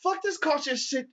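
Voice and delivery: high, loud offended grandpa; the old man challenging the cop, high and loud; indignant old man. Retold or imitated. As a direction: sound scandalised and high. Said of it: "he was just like".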